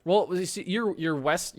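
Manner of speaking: ordinary talk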